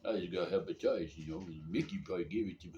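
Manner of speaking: low boxer impression; Rocky Balboa. Sick man blaming Mickey for hepatitis, low boxer impression. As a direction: mumble along like a hapless movie boxer. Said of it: "start sounding like Rocky Balboa".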